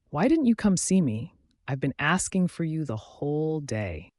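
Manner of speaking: ordinary delivery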